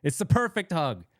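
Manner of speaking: high, playful insistence; mock insistent hugger. Telling Brian the too-tight hug is perfect, playfully high and insistent. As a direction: refuse to let go, teasingly high. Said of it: "I'll be like".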